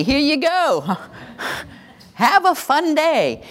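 high, breezy and cheerful; chirpy mom. Retold or imitated. Mom handing her child to daycare, high and cheery. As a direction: sing it out like a carefree mom.

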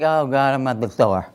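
low, dragging "Oh God, I'm at the store"; mock weary groan. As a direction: sigh it out like a dreaded chore. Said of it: "you're not like".

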